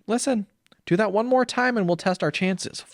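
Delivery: high, sugary threat; menacing sweet mom. Retold or imitated. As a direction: threaten him in a syrupy high voice. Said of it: "And she's like".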